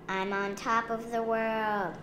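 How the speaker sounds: child's triumphant call, lightly voiced; gleeful shout. A narrator's reading. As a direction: call it out from the top, held back in volume. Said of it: "she shouted".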